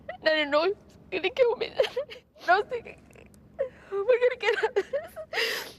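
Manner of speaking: fighting back sobs while telling it; tearful, shaky retelling through a high, strained voice; real crying. Real, not acted. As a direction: voice pitched high and breaking, half laughing through tears.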